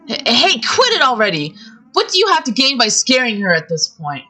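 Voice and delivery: pleading her to stop; boy protests the cruel threat; upset protest. Acted.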